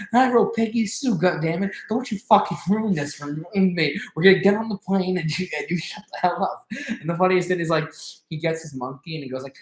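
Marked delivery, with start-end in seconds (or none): 0.1-6.7 high, loud mock tirade; retold angry yelling. Star berating his scared bandmate about the plane, mock angry. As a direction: scold in a raised, hammed-up voice. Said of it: "yells at him".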